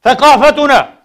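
loud, high, ringing single word; emphatic shout. Real, not acted. Preacher repeating the lesson about our culture, emphatic shout. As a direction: proclaim it so everyone takes note.

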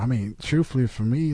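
usual speaking voice

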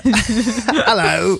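high, giggling mock-Australian greeting; badly faked Australian, breaking into laughter; attempted Australian accent. Second try at ordering in Australia, giggly Australian accent. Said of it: "order from McDonald's in Australia".

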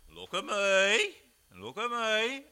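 loud, slow call to look at him; Peter's command. Acted. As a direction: demand his eyes, slow and loud. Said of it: "Then Peter said".

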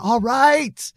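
high, loud outburst cutting in; yelling. A narrator's reading. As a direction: explode over the other voice. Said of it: "Billy was yelling now".

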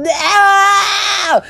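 high screaming no. Retold or imitated. Screaming at Ken as his hand goes in. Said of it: "I'm screaming".

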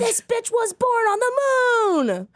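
high-pitched dramatic exclamation; mock outraged revelation. Comedian playing her own truther, mock outraged. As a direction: cry it out like a wild exposé.

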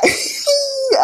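squeaky teary laugh; a shrill laugh that almost cries; high crying laugh. Real, not acted. Laughing at own emotion.

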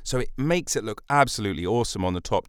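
usual speaking voice

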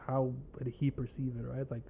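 usual speaking voice

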